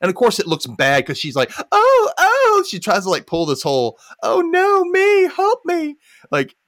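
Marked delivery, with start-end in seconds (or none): 1.7-2.6 loud, high play-acted distress; mock victim cries. Acting Betty's show for the cops. 4.2-6.0 high, loud, drawn-out plea; hammed-up cry for help, much higher; mock helpless victim. Acting Betty's show for the cops.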